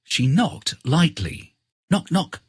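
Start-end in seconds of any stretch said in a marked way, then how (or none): none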